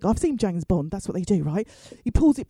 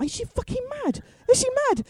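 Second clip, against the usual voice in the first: high-pitched incredulous outburst, acted; mock incredulity; 13 semitones higher than usual. Relatives react to her cream request, high mock incredulity. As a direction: squeak it in disbelief. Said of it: "They all look at me like".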